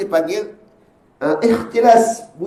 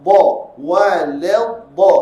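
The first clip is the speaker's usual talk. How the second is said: slow drawn wrong reading; 2 semitones lower, much slower than usual. Shown as a demonstration. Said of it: "yang baca walad".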